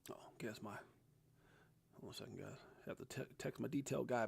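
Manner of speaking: hushed aside. Real, not acted. Phone goes off mid-stream.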